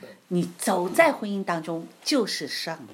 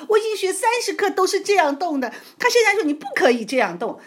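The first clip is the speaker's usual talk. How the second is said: much higher, louder exasperated outcry; indignant, raised retelling of the struggle; 10 dB louder than usual. Retold or imitated. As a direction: vent the frustration, pitched way up. Venting frustration while retelling.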